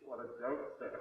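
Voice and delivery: normal talking voice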